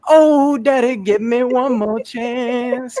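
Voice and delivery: high, slow, put-on little boy voice, begging; pleading child.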